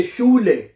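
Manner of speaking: शूले opened with a clear "sh" sound; teacher's model of शूले, starting on "sh"; "sch" as sh. Shown as a demonstration. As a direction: say शूले as "SHOO-leh". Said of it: "जहां पे शीन".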